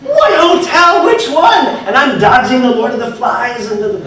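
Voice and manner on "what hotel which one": high, loud, slow exasperated asking; exasperated shout. Retold or imitated. Speaker on phone to wife in crowd, exasperated shout. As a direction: bark the questions down the phone.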